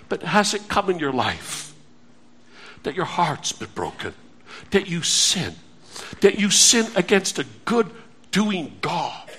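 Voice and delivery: softly pleading sermon voice; hushed appeal. Acted. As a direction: draw them in with a near-hush.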